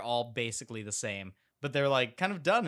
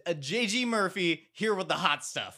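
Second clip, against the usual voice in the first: mock announcer; 5 semitones higher, 3 dB louder than usual. Mock radio host intro.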